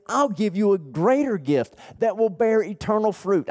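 high-pitched, driving sermon delivery; impassioned preaching. Acted. Preacher urging listeners about life and eternity, impassioned preaching. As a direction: press each point home with fervour.

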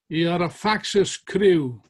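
slow, loud, accusing call. Retold or imitated. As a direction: call it out across the chamber. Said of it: "Cromwell called out".

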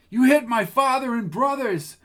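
put-on a girl crying out in shock; distraught girl. Lucy protests, distraught.

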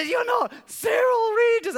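very high, loud, slow disbelieving cry; starstruck disbelief. Retold or imitated. Pastor to the humble stranger at the door, high amazed shout. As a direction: shout it like you can't believe who's in front of you. Recognising famous footballer.

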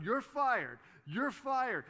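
high, loud gleeful firing; kid firing. Retold or imitated. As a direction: shout it like a kid playing boss.

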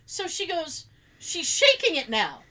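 ordinary talk, nothing marked

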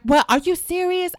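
can't believe what she's hearing; shocked disbelief. Retold or imitated. Sister shocked at being told.

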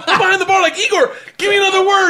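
very high, loud, giddy yelling; excited shout. Retold or imitated. Behind the bar, yelling to Igor for another word, very high and loud. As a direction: yell eagerly for the next word. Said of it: "I go, I go".

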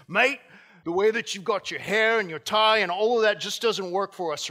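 loud, high Australian accent imitating a college dean; hammed-up loud Australian scolding. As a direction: belt it out as a gruff Australian official. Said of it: "I just didn't get the accent there".

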